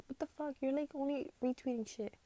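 ordinary talk

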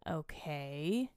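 low, soft, drawn-out okay lifting at the end; mock-agreeing, low and questioning; sarcastic okay. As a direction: humor her with quiet, doubtful sarcasm. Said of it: "He's, like, so sarcastic".

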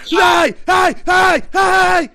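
high, very loud repeated yells; hammed-up frenzied hollering; mock shouting. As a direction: shout each word like you're rattling a cage. Acting out terrorising a caged child.

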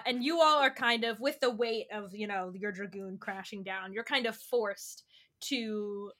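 normal talking voice

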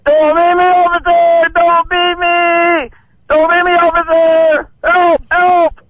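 high, drawn-out mock-desperate yell; yelling for help. Prankster yells at police on command, mock-desperate yell. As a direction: holler it like a staged police beating. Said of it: "just start yelling as loud as you can".

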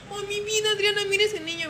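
put-on calling her coworker over to see the boy, excited and high. Excitedly points out ghost boy.

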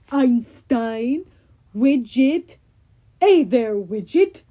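slow, loud giant voice; Cyclops voice. Acted. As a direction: drag the name out like a brutish giant.

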